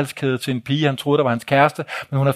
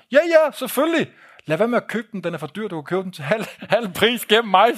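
much higher, eager, ending in laughter; chuckling conspiratorial offer, pitched up; excited high voice; 7 semitones higher than usual. Acted. Omar tells the narrator to buy through him, high and laughing.

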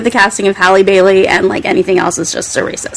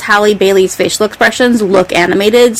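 ordinary talk, nothing marked

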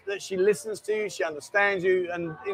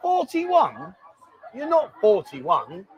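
incredulous; much slower than usual. Real, not acted. Host questioning a guest's age, incredulous. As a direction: blurt it back in disbelief.

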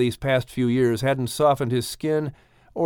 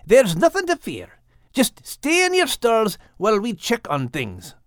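Scottish brogue; 7 semitones higher than usual. Acted. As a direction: reassure the animals in a Scottish burr. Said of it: "Max said with his loud Scottish brogue".

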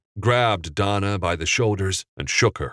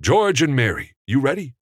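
higher, louder call across the set; calling to actors; 8 semitones higher, 3 dB louder than usual. A narrator's reading. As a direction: director checking his leads are ready. Director calls to actors.

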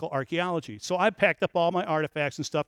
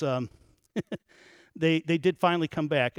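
normal talking voice

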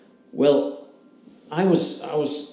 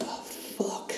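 stunned whisper; 9 dB quieter than usual. Retold or imitated. Learning of the miscarried daughter.

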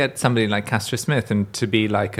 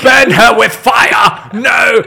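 much higher, loud hammed-up shout; mock-furious shriek, higher and louder; mock outrage; 12 semitones higher, 10 dB louder, slower than usual. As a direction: scream in playful horror at the idea. Outraged at bolo ties comeback.

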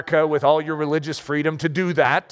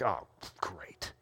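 whispered sarcastic grumble; sarcastic whisper at a dinged windshield; grumbled sarcasm; 12 semitones lower, 17 dB quieter than usual. Retold or imitated. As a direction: mutter it under your breath. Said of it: "after I grumbled a little".

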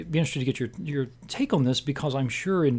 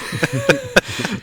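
loud burst of real laughter, high; 12 semitones higher, 7 dB louder than usual. Real, not acted. Reacts to the spoiler joke.

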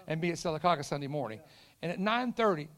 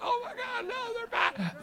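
high, squealed mock dread; mock panic; slower than usual. Restaurant staff seeing the youth group return, mock panic. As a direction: squeal it in exaggerated alarm.

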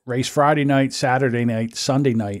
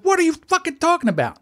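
mock indignant retort. Husband retorts to wife.

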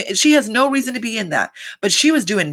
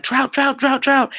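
higher, chanted call of trout trout; mock yelling instructors; 7 semitones higher than usual. Instructors yelling trout while running, mock yell. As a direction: bark it like a fishing guide hyping the crowd. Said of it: "yelling trout".

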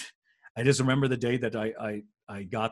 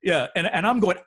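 ordinary talk, nothing marked

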